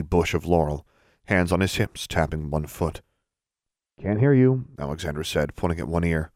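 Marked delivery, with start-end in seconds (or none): 4.0-4.7 higher, louder call over plugged ears; raised voice. A narrator's reading. Shouting over earwax.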